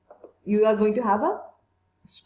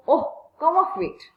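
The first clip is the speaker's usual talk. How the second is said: dismissive retort; 7 semitones higher, slower than usual. Acted. Teacher reads Edmund's retort.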